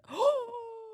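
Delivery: high, drawn-out gasp; shocked gasp. Real, not acted. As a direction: gasp in astonishment. Reacting to viewer's exam score.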